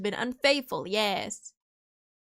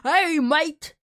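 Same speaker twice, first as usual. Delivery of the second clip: British greeting, higher and louder; mock British accent; 4 semitones higher, 5 dB louder than usual. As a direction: call out like a rowdy British caricature. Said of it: "British people who are like".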